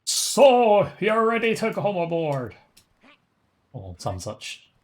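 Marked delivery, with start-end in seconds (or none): 0.0-2.5 loud, high-pitched call to come aboard; put-on character voice. Game character inviting a passenger aboard, high put-on voice. Voicing game dialogue about boarding.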